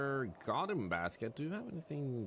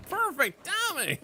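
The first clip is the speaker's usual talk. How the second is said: laughing; 6 dB louder than usual. Real, not acted. Laughs at ad timing.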